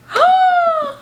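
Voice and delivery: loud, high gasp of delight; sharp delighted gasp. Real, not acted. Vlogger seeing the book, gasping in delight. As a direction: suck in air, thrilled.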